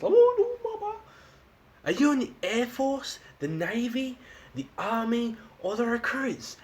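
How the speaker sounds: high-pitched call to a stranger. Retold or imitated. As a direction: shout it high across to the other island.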